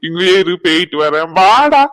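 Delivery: loud, slow mocking jab; mock the man, then call the kid; sneering sarcasm rising to a shout; sarcastic scolding. Sarcastic scolding, then calls child.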